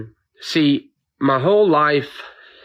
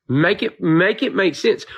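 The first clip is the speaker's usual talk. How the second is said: exasperated plea; 6 semitones higher than usual. Real, not acted. Repeats demand, more upset.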